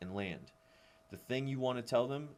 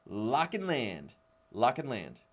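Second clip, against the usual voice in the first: slow, louder Lock and land, repeated; called cue; 2 semitones higher, 6 dB louder, slower than usual. Shown as a demonstration. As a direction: chant the cue as they lock out. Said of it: "you just wanna say".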